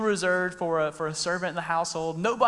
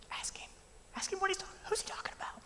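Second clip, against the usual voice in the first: whisper; 14 semitones higher, 12 dB quieter, faster than usual. Retold or imitated. Peter urging John across table.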